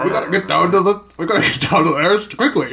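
put-on young actor faking an old man. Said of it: "he's putting on his old man voice".

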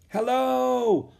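high, carrying answering hail; calling back. A narrator's reading. Woman at the academy answers the raft, calling out. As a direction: call back across a distance. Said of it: "a woman called back".

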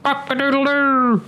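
long, very high slow crow; high drawn-out cock-a-doodle-doo; rooster crow. Acted. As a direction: stretch it out like a rooster waking. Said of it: "stretched its neck and crowed".

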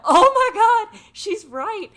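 loud, high burst of amazement; astonished realisation. Retold or imitated. Daughter realising mom is right, loudly astonished. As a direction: exclaim it as the truth lands.

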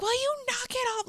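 high-pitched, fast mock outburst; imitated snapping. Sally hitting the ex in the car, imitated high-pitched snapping. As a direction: snap in a squeaky, hurried retold scolding. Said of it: "she literally just, like, snaps".

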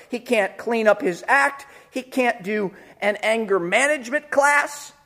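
ordinary talk

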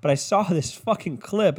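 ordinary talk

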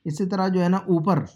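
ordinary delivery

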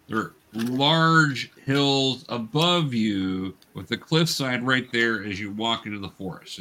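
normal talking voice

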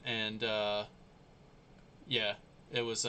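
ordinary delivery

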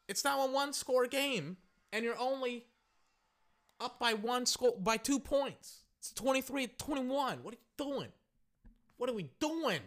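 high-pitched disbelief; exasperated. Acted. Fan lectures Seattle on the close score, exasperated. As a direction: keep the voice up high, fed up and baffled.

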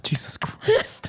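slow, high unvoiced sigh; exasperated whisper. Real, not acted. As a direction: breathe it out in disbelief. Hair in the drink again.

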